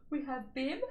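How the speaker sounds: words stretched by a stifled yawn. Real, not acted. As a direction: let a real yawn creep into the words. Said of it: "excuse me, yawning".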